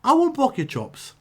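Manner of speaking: mock soothing to Bob. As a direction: sweetly reassure the big guy in a high voice. Reassuring Bob before the match.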